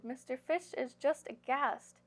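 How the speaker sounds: plain reading voice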